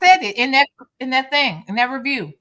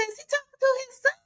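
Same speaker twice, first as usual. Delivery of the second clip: high mock quote; 8 semitones higher than usual. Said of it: "I said".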